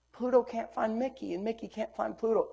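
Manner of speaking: put-on very soft, mildly tearful little-boy voice; hushed, imitating a child near tears. Father retelling his son's worry over Pluto, softly tearful. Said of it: "I see a tear well up".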